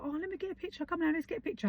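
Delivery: high, soft coaxing mum voice; a mother softly coaxing her son to pose, high and sweet; coaxing sing-song. Retold or imitated. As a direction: sweet-talk a shy kid into a photo.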